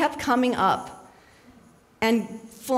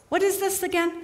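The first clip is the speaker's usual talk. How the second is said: Robin Williams impression; 8 semitones higher than usual. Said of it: "That was Robin Williams".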